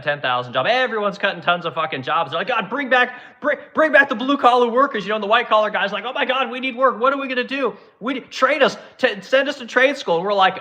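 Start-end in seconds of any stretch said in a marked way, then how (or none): 2.5-5.0 higher and louder, begging; mock pleading. Employers now begging for blue-collar workers back, high mock plea. 6.0-10.2 higher, whiny panicked office worker; mock panic. Laid-off office workers begging to be sent to trade school, high whiny panic.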